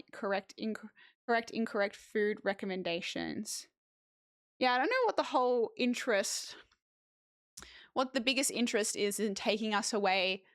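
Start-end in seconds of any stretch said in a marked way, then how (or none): none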